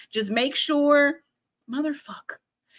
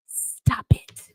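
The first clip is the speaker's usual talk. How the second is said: whispered stop it. Acted. Tarot reader warning the viewer, in a whisper. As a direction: hiss the warning under your breath. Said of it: "I'm yelling at you".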